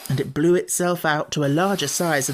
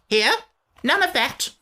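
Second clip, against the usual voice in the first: high, grumpy creature voice; a cross little sand-fairy; Samiad voice; 12 semitones higher, 3 dB louder than usual. Acted. Samiad character voice.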